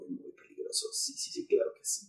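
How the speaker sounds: hushed whisper. Real, not acted. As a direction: drop to a whisper. Whispered after loud prank.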